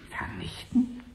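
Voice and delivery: breathless, high whisper of disbelief; a scientist whispering, aghast, as he is told to destroy his serum; stunned whisper. Acted. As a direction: gasp the word out in shock. Shocked at demand to destroy.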